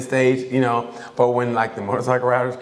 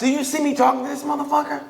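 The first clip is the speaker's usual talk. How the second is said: annoyed; 13 semitones higher than usual. Retold or imitated. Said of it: "just start talking like".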